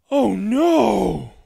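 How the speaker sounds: a narrator's hammed-up cry of dismay. A narrator's reading. X remembers the broken xylophone.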